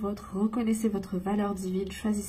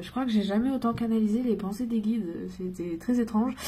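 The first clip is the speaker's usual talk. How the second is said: ordinary delivery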